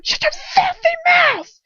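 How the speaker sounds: loud, slow roaring shout; roared. A narrator's reading. Captor roars at the girl to shut up. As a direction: roar it to shut her up. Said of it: "he roared".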